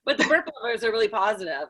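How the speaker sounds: normal talking voice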